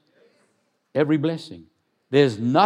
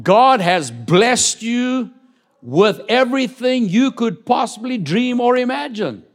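fervent preaching; 10 semitones higher, 6 dB louder than usual. Acted. Preaching rise.

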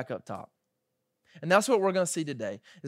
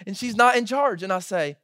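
ordinary talk

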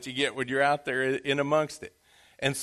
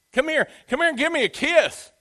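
put-on old father calling, higher; 11 semitones higher, 4 dB louder than usual. Isaac calls son closer.

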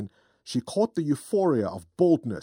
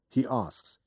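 plain reading voice

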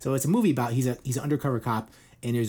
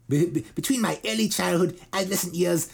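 imitated British accent, a little raised in pitch; 5 semitones higher than usual. Singer describes his planned memoir, mimicked British accent. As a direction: play the British singer holding forth. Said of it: "Why am I doing his accent?".

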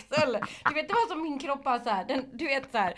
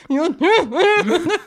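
higher, louder, laughing through clenched teeth; 7 semitones higher, 9 dB louder than usual. Retold or imitated. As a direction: squeeze it out past the teeth. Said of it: "prata genom mina tänder". (pressat genom tänderna med skratt)

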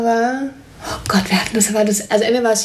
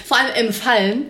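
ordinary delivery

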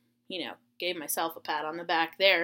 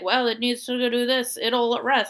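lightly voiced grandpa, a little higher; grandpa impression; 4 semitones higher, 4 dB louder than usual. Grandpa instructs over the phone, grandpa impression. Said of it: "And he's like".

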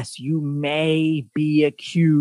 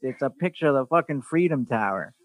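ordinary delivery

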